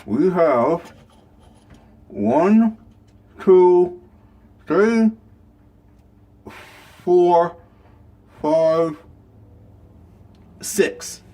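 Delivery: talking around a flashlight held in mouth; counting with a flashlight between teeth. Real, not acted. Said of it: "I got the flashlight in my mouth".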